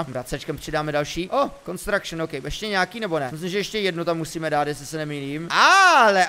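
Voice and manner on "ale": high, loud drawn-out cry of surprise; surprised exclamation. Real, not acted. Player reacting to a find in the game, surprised. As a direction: let the word swoop up loudly.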